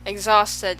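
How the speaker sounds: plain reading voice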